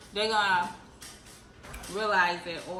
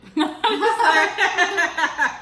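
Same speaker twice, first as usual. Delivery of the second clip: loud burst of laughter; 9 semitones higher, 13 dB louder than usual. Real, not acted. Laughing at own joke.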